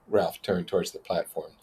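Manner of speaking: ordinary delivery, nothing marked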